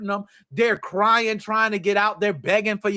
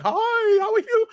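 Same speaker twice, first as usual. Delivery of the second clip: ghost greeting; 13 semitones higher, slower than usual. Pretend. Ghost greeting him while running.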